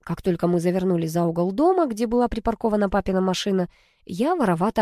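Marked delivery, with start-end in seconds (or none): none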